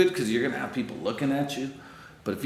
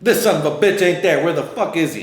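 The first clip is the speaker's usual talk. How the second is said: louder, higher frustrated swearing; annoyed outburst; 6 semitones higher, 6 dB louder than usual. Retold or imitated. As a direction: curse the empty spot. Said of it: "I hit the corner".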